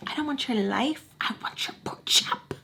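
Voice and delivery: soft, whispery joke quote; mock whisper. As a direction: drop to a husky whisper for the quote.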